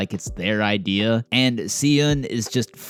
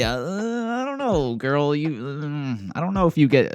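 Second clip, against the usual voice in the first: sassy doubt; 5 semitones higher than usual. Real, not acted. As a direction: stretch it out in disbelief. Doubtful aside to the character.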